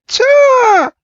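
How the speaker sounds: very high cha cry; imitated shrieking yelp; creature squeal. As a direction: squeal like a small startled creature. Imitates Charmander hit by water.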